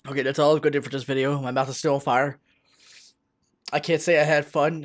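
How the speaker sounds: usual speaking voice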